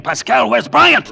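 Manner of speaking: loud alarmed demand; angry demand. Acted. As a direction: demand it sharply.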